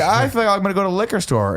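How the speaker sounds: high-pitched mock excuse; feeble excuse. Passenger to Uber driver, excusing early exit, high-pitched. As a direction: imitate himself offering the driver an excuse, voice pushed up.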